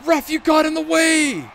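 shouting at the ref; loud protest at the ref; pleading with the referee. Real, not acted. Complaining to the ref.

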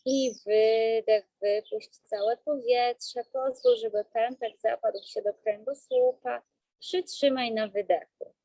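slow, calm breathing-coach voice; guided breathing. Acted.

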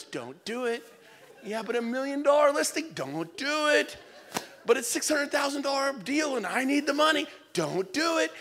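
a warning voice pleading, lightly played; little inner voice. Inner voice warning the agent off a bad deal, mild plea. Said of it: "that little voice that goes".

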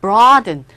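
"broaden" as two syllables, "braw-den"; firm, loud model "broaden" with br run together; correct English pronunciation. Shown as a demonstration. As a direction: open "aw" vowel in "broaden". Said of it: "이런 발음이 납니다".

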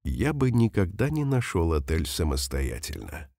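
ordinary talk

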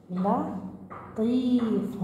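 لطيف stretched out very slowly; slowly spelled-out لطيف; very slow word. Shown as a demonstration. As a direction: draw out لطيف syllable by syllable. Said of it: "هنا".

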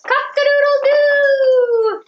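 high, loud, drawn-out rooster crow; big stretched-out cock-a-doodle-doo. Acted. Rooster crowing in farm story.

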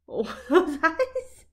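loud, slow laughing aww. Real, not acted. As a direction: laugh it out over sad eyes. Laughing at offended dog.

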